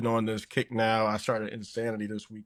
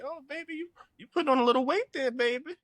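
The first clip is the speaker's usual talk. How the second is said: doting grandparent; faster than usual. Retold or imitated. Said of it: "and they'll say".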